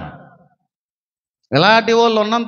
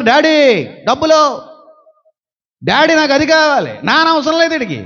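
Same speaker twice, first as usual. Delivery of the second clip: put-on demanding child; 5 semitones higher than usual. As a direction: whine for daddy's money. Greedy child demanding money. (డబ్బుల కోసం మారాం చేసే పిల్లాడు)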